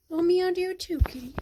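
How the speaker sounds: high, playful reply to a meowing cat; pet talk. Real, not acted. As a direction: chirp it back to the kitty.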